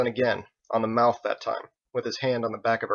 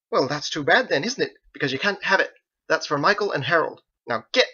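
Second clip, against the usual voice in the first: higher, sassy scolding; stern scolding; 5 semitones higher, 4 dB louder than usual. A narrator's reading. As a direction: put him in his place. Fists on hips scolding.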